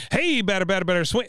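high, sing-song taunting; a kid heckling from the stands; mock kid taunt. Bobby taunting Bill in a high sing-song. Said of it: "He goes".

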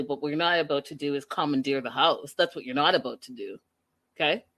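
sassy low voice telling Mike off. Retold or imitated. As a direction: put him in his place, low and steady. Voicing the girlfriend scolding Mike.

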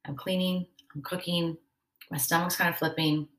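quiet inner voice while cooking and cleaning; inner monologue. Retold or imitated. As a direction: murmur it as a private inner check. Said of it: "dialogue inside".

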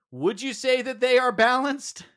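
loud, high courtroom cross-examination; passionate closing argument. Pretend. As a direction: grill the witness like a prosecutor. Mock cross-examination of witness.

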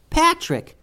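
very high squeaky mouse voice. Acted. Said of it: "squeaked the mouse".

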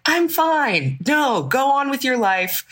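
high, drawn-out mock "don't worry about me"; faked brave face. Showing the faking that Gilda never does, high mock-brave reassurance. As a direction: put on a high, falsely fine voice. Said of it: "never fakes it for anybody".

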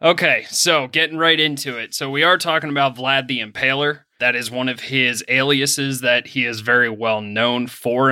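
usual speaking voice

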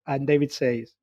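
plain reading voice

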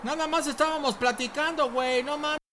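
hushed talk. Acted. Caught friend talks low through the door, hushed. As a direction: keep your voice down so he won't hear.

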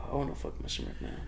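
a quick whispered aside; joking whisper. Real, not acted. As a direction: slip the line out under your breath. Joking aside under his breath.

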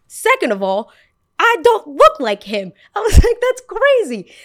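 very high, loud, outraged; denying she looks like Shrek; outraged squeal. Retold or imitated. Very high, loud, outraged.

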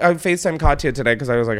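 ordinary delivery

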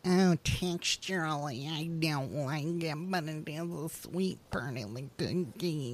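soft, slow, fussy voice; a quiet, slow, hesitant critique of the texture; picky eater voice. Retold or imitated. Quoting picky eaters' opinion.

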